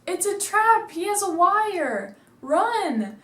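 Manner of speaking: high, loud panicked shout; yelling warning. Retold or imitated. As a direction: yell the warning to run, urgent. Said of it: "and Joe yells".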